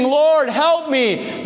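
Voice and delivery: desperate plea. Retold or imitated. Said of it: "She's screaming after us".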